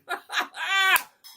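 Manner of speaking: high, loud burst of laughter. Real, not acted. Amused by the Mister Ed theme, high laugh. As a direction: laugh out at your own joke.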